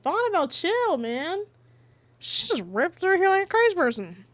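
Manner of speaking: high-pitched fond complaint; exasperated scolding. Real, not acted. Scolding Bonnibel for ripping through.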